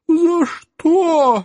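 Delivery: very high squeak; squeaky whimper. Acted. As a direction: squeak it out in pain. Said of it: "с трудом пропищал эльф".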